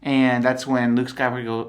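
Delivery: ordinary delivery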